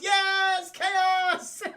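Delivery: high, loud excited cry; excited kids. Retold or imitated. Kids cheering as Batman pulls down the bell, high excited cry. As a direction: cheer the chaos gleefully.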